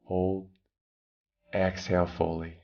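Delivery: usual speaking voice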